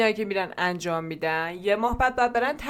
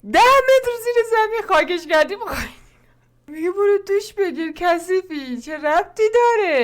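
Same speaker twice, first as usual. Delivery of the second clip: high, loud disbelief; exasperated why-would-you; incredulous; 6 semitones higher, 4 dB louder than usual. Real, not acted. Host mocks mother's logic. (ناباوری بلند و زیر)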